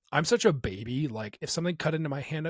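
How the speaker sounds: ordinary talk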